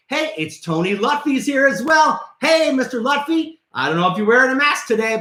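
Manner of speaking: normal talking voice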